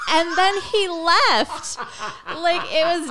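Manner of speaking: high, loud, slow laughing talk. Real, not acted. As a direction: laugh through the punchline. Laughing at the punchline.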